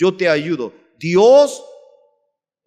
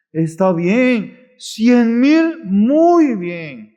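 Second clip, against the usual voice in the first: slow, raised, drawn-out delight; elated announcement; 5 semitones higher, 4 dB louder, much slower than usual. Acted. Minister shares the agreed price with the brethren, elated. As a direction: announce it slowly and loudly, delighted.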